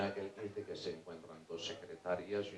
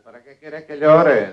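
loud, high, drawn-out question; acted baffled shout; calling out; 4 semitones higher, 15 dB louder, slower than usual. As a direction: shout the question back from the water. Drowning man shouts question back.

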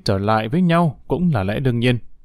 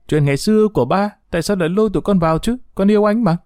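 higher, hurried pleading; pleading protest; 7 semitones higher, faster than usual. A narrator's reading. Daughter defending her love to her father, high hurried protest.

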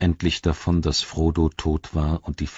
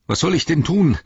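high, louder cry of helplessness; desperate, raised-pitch exclamation; calling out; 11 semitones higher, 6 dB louder than usual. A narrator's reading. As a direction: cry out the question, at a loss. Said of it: "rief er noch einmal".

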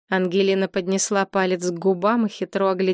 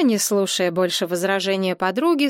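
usual speaking voice

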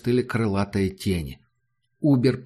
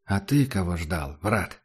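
low, quiet ghostly voice; cold otherworldly voice; 5 semitones lower, 4 dB quieter than usual. Acted. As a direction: speak from beyond the grave, softly. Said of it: "холодноватым, потусторонним голосом".

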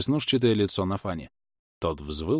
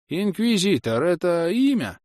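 put-on high-pitched puzzled old man; puzzled old villager; 10 semitones higher than usual. Old man asking about the word, puzzled high voice. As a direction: ask in a high, drawn rustic voice.

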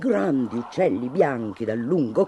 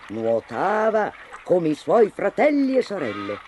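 usual speaking voice

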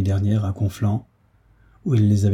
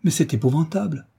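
higher, frightened exclamation; cry of fright; 7 semitones higher than usual. A narrator's reading. Young girl seeing his wound, frightened higher cry. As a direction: recoil at the wound, voice jumping up. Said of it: "poussa un cri d'effroi".

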